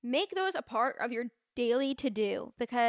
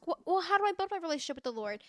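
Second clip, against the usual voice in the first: curious questioner; 6 semitones higher, faster than usual. Retold or imitated. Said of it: "you might ask".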